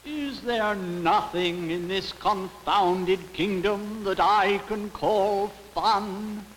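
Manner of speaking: high, slow, drawn-out royal complaint; a king wailing slowly in exasperation; exasperated king. A narrator's reading. King complains of his confounded kingdom, exasperated.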